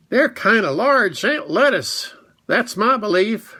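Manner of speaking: high, homely little rabbit; a small creature sure of its opinion. Acted.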